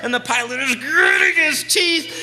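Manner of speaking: loud, high-pitched thrilling narration; excited storytelling. Retold or imitated. Teller recounts the overloaded plane's takeoff, loud and high-pitched. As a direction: tell the takeoff loudly, at a high pitch, as if living it.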